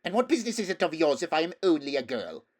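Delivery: put-on the girl snapping at Shasta. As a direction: snap it back in offense. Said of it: "snapped the stranger".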